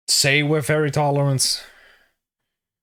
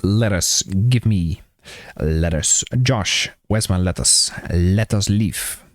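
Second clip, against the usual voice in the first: low voice demand; 8 semitones lower, slower than usual. Pretend.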